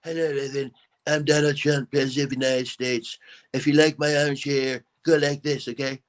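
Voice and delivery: presidential impression greeting a fan; Donald Trump. Shown as a demonstration. Impressionist saying hello to host's relative Evan, as Donald Trump. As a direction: put on the president's distinctive voice. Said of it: "can you do Donald Trump".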